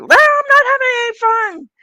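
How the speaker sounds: high, loud put-on voice of a cranky sister; mock-whiny high retort from a stressed sister; grumpy sister. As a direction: squeak it out like a sulking sibling.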